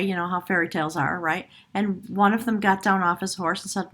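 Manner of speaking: ordinary delivery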